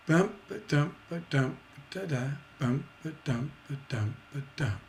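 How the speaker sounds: softening chant, ending on stressed dumps; feminine line resolved by masculine. Shown as a demonstration. Teacher chanting a feminine line resolved by a masculine. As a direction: chant the hanging beat, then resolve it. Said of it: "to resolve it".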